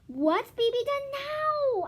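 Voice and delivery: high, loud, slow weary question; mother on the phone, fed up; exasperated mother. Acted. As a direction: sigh it out as a fed-up parent. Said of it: "asked Missus Gunn".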